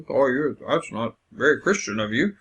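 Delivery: mock disapproving church voice; tut-tut at them in a preachy way; a hint of a judgmental churchgoer. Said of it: "or like".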